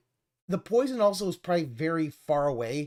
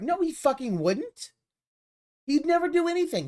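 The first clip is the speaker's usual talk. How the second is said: indignant outburst; 11 semitones higher than usual. Real, not acted. Ranting to chat about a careless dad, indignant outburst. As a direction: burst out in disbelief.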